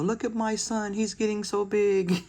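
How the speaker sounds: high, proud gushing mom voice. Retold or imitated. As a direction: coo like a proud mother. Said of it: "she just take a little short video".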